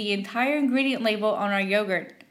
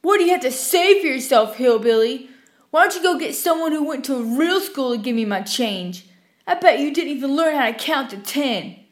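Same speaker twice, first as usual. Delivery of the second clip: taunting customer; 3 semitones higher than usual. Retold or imitated. Rude customer taunting the cashier.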